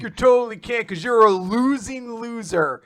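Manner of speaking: loud, high jeering villain; hammed-up gloating jeer; mocking taunt. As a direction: crow the insult loudly and high. Acting out villain taunting his opponent.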